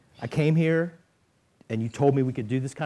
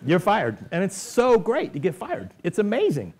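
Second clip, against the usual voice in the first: excited; 8 semitones higher, 5 dB louder than usual. Acted. Gleeful mock firing line.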